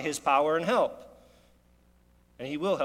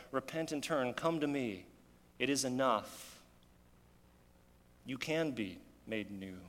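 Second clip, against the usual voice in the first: softer and slow, tender call; God calling the sinner home, softly and slowly; gentle invitation; 5 dB quieter than usual. Acted. God's gentle call to repent.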